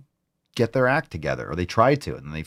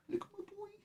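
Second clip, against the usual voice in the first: whisper to dog; 24 dB quieter than usual. Real, not acted.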